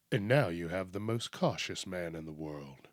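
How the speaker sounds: low, soft announcer voice; mock ad announcer. Spoofing the most interesting man in the world, deep ad announcer. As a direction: drop deep and quiet for a parody tagline.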